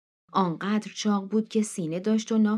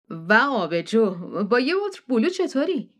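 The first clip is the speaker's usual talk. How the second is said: Matt's jovial offer; 3 semitones higher, 4 dB louder than usual. Acted. Matt offers Neil a beer.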